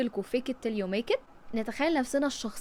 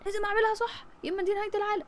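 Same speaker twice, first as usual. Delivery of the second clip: high, fast panicked inner voice; mock panic of a perfectionist; panicky perfectionist; 9 semitones higher, faster than usual. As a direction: rattle it off like the world is ending. Voicing a perfectionist's panicked thoughts.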